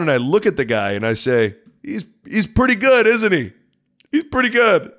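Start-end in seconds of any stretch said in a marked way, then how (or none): 1.8-4.9 smug taunt. Retold or imitated. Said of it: "And give him a little smile".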